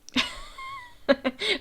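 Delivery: high-pitched amused giggle; high laugh. Real, not acted. Laughs at the manga scene.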